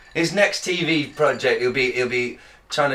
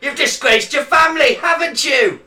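mock berating shout; 9 semitones higher, 6 dB louder than usual. Mimicking Jeremy Kyle berating.

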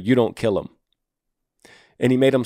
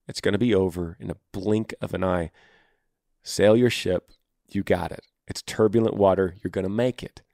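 normal talking voice

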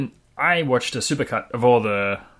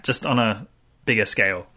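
usual speaking voice

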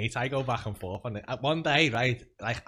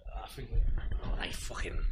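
whispered; 3 semitones lower, 6 dB quieter than usual. Real, not acted. Mic trouble muttered aside.

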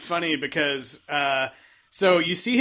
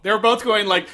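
normal talking voice

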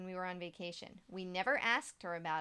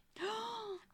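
high, sharp intake of breath; shocked gasp; 13 semitones higher, 4 dB quieter than usual. Real, not acted. Host reacting to a creepy child story, shocked gasp.

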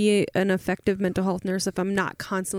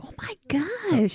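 amazed whisper; 8 semitones higher, 3 dB quieter, much slower than usual. Retold or imitated. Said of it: "You always come with something where I'm like".

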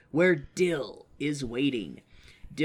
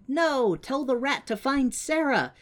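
put-on higher kid voice; 9 semitones higher than usual. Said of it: "they're like".

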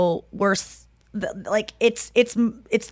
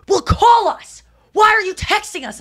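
parents snapping back; 12 semitones higher, 4 dB louder than usual. Retold or imitated. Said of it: "I go".

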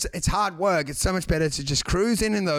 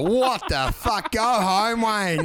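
drawn-out, higher jeer; mock disgusted dismissal; 5 semitones higher, much slower than usual. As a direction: send him packing in mock disgust. Mocks group rejecting Wayne's bad line.

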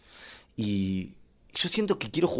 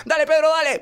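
high, loud egging-on yell; urgent, high-pitched cheering at a young son; 13 semitones higher, 5 dB louder than usual. Acted. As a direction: shout encouragement like an over-invested dad. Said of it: "Y lo aliento".

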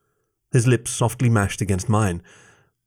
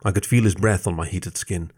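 usual speaking voice

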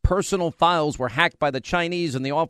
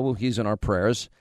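ordinary talk

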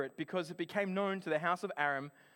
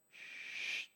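a soft unvoiced shh like something moving; whispered shush noise; 12 dB quieter than usual. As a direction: breathe out a long quiet shh. Noise heard in the roof.

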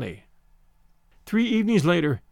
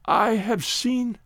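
sobbing; 5 semitones higher, much slower than usual. Acted. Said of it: "Tessa sobbed and tried to speak".

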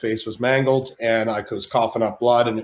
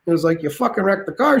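higher put-on De Niro; 7 semitones higher than usual. De Niro challenging Shane over the wreck, put-on voice. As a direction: push back as De Niro. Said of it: "He's like".

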